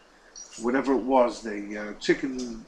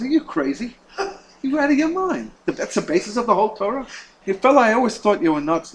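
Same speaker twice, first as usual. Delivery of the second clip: much higher and louder disbelief; incredulous scoff; 8 semitones higher, 6 dB louder than usual. Retold or imitated. Professor scoffs at the rabbi's answer, loud and incredulous. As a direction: scoff at the idea, loud and high.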